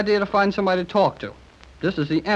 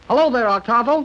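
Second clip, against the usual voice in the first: calling out; 2 semitones higher, 3 dB louder than usual. Acted. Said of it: "He's very deaf".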